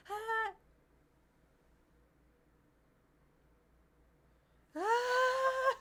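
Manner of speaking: long, very high sustained scream. Retold or imitated. Going up the loop, she holds a long high scream. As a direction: hold a piercing scream up the loop. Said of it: "I'm like".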